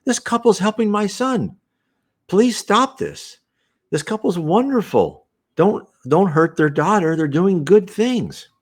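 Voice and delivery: very high, pleading mother's voice; a discarnate mother defending her son, voiced high and pleading; pleading spirit mother. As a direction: beg them to stop, high and earnest, slowing toward the end.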